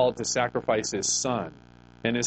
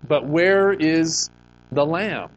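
a son's pleading question, drawn out and louder; Isaac asking, slow and loud; 4 semitones higher, 6 dB louder, much slower than usual. Acted. Son asking his father on the mountain, slow and louder. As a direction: stretch out where is, louder, like a puzzled son.

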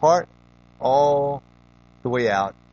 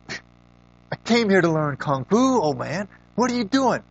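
put-on a pitched-up, indignant teen protest; frustrated boy; 8 semitones higher, 3 dB louder, slower than usual. Boy protesting to his kung fu master. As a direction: whine it out like a fed-up kid.

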